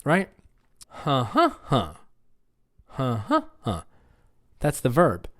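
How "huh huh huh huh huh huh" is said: "Huh, huh, huh" with middle beat raised; second-syllable stress pattern. Shown as a demonstration. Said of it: "say the second syllable".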